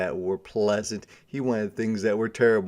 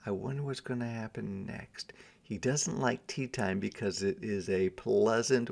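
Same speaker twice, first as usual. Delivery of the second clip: usual speaking voice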